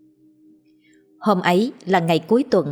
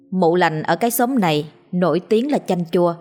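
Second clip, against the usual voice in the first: plain reading voice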